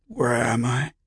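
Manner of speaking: high, raspy questioning croak; rasping. A narrator's reading. Patient asking the unseen voice where he is, rasping. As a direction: croak it out, rising at the end. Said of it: "The rasp in his voice".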